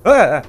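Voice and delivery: high, loud scream of fright; startled scream. Real, not acted. Player jolted by an enemy appearing, screaming. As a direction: yelp in sudden shock.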